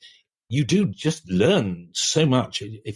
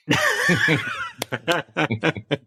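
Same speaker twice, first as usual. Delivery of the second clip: big laugh; 7 semitones higher than usual. Real, not acted. Punchline of his own story.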